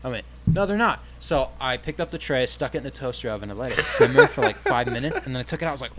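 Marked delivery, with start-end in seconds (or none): 0.5-1.0 insistent denial. Retold or imitated. Retold argument with coworker.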